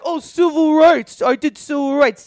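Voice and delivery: high, loud, slow self-important boast; mocking the Kennedys, loud pompous boast; mock pompous politician. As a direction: ham up a smug politician bragging. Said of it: "they're like".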